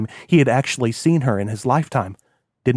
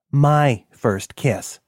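very slow, pointed; slow embarrassed correction; much slower than usual. Acted. Jonathan correcting Kilroy, slow and embarrassed. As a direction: correct him word by word.

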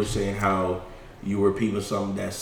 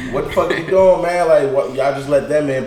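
loud, raised-voice retold outburst; pissed off; 4 semitones higher, 6 dB louder than usual. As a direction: act out confronting the doorman, indignant. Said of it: "I was pissed off".

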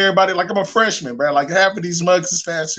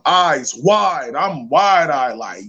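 usual speaking voice

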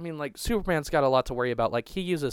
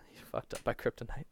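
laughing whisper; 2 semitones lower, 11 dB quieter than usual. Real, not acted.

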